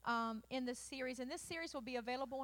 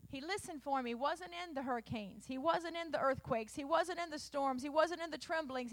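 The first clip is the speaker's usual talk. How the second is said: usual speaking voice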